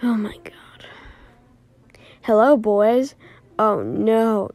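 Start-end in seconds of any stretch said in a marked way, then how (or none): 0.0-0.9 breathless frightened whisper; terrified whisper; scared whisper. Acted. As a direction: whisper in horror. Fright at monster.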